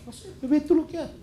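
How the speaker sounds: high-pitched put-on brush-off; acted dismissive reply. Pastor answering a parent who asks for help, dismissive imitation.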